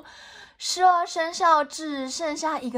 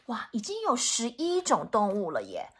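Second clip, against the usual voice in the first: ordinary delivery, nothing marked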